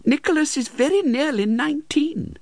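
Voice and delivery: high, timid widow. Acted. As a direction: answer meekly in a high voice.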